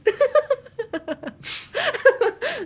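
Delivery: high, long burst of laughter; real laughing fit. Real, not acted. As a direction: crack up at your own demo. Cracks up at her own demo.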